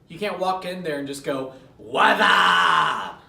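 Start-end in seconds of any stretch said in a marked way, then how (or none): 1.8-3.2 loud, drawn-out mock shout; over-the-top hollered greeting; unprofessional shouted greeting. Shown as a demonstration. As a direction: yell it like a rowdy, unprofessional hello. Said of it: "Don't use something unprofessional".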